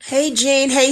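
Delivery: normal talking voice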